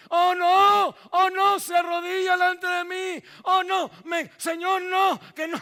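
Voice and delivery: high, loud shouted "oh no"; protesting shout. Acted. Disciple refuses the Lord washing his feet, shouting. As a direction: cry out in alarmed refusal.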